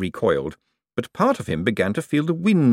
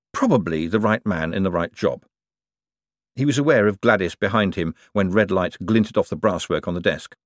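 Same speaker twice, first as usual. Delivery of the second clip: ordinary delivery, nothing marked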